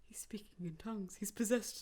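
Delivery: fast hushed whisper. Pretend. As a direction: whisper it quickly like gossip. Whispered joke about the accent.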